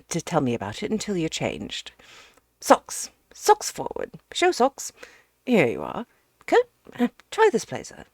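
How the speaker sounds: loud, hearty, brisk host; booming friend fussing over a wet guest; bustling Ukridge. Acted. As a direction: bark out the clothes cheerfully. Ukridge dresses the professor.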